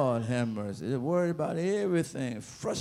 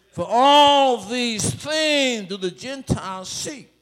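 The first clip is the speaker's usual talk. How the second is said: louder, higher, slow and ringing; full-force preaching; 5 semitones higher, 9 dB louder than usual. Acted. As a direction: proclaim the verse to the whole church.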